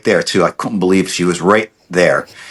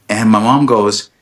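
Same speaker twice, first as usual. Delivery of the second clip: normal talking voice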